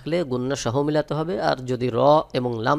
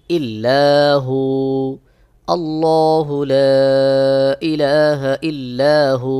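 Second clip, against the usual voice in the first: slow, measured Quran recitation, a little louder; 4 dB louder, much slower than usual. Shown as a demonstration. Teacher reciting for students with a pause at each stop. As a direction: recite slowly, stopping at each waqf. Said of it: "যেমন".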